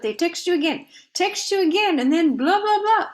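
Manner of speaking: playfully overwhelmed, pitch climbing with each repeat; mock exasperation. Meditation leader griping about people who keep texting, mock exasperation.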